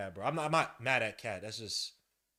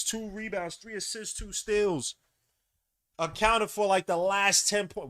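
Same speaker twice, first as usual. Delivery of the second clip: loud, high-pitched game recap; hyped up; 8 semitones higher, 8 dB louder than usual. Real, not acted. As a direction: announce the comeback with big hype. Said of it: "I got all hyped up".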